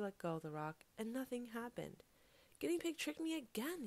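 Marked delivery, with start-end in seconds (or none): 2.6-3.9 high-pitched exasperated wail; outraged cry. A narrator's reading. Fox finds the rock was a trick, crying out. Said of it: "he cried".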